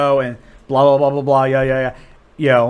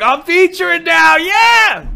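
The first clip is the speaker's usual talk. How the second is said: high, loud mock-excited shout; hyped-up boast; 6 dB louder, slower than usual. Comic thrilled to be featuring, mock excited shout. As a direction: crow it like a thrilled newcomer. Mocks an overexcited new feature act.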